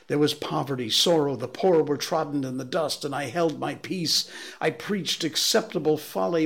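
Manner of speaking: a broken preacher lashing himself; raving curate. A narrator's reading.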